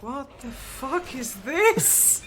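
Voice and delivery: slow, high, stunned disbelief; baffled exclamation. Retold or imitated. Newcomer to the country reacting to the Harry Enfield sketch, high and baffled. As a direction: drag it out like you can't believe your eyes. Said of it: "and I was like".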